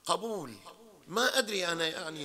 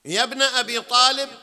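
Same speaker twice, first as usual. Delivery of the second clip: loud calling out; 7 semitones higher, 12 dB louder than usual. Shown as a demonstration. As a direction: call out يا ابن أبي طالب loud and high, stretching طالب. Calling out the name, repeated. (نداء بصوت عالٍ)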